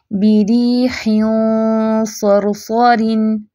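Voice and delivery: बिरीहिन सरसरिन with a thick, heavy nasal ghunna, very slow; heavy ghunna ikhfa. Shown as a demonstration. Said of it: "इख्फा भी मोटा करें". (बिरीहिन सरसरिन में मोटा गुन्ना)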